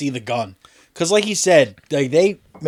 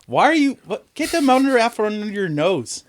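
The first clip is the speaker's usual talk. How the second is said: high indignant voice; 6 semitones higher than usual. Pretend. Acted scolding of pedophile.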